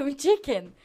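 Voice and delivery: "chicken" said in place of ticket, loud; loud quizzical "Um chicken?"; mispronounced ticket. Retold or imitated. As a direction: say chicken where ticket belongs. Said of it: "Só que eu falei".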